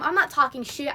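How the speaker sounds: ordinary talk, nothing marked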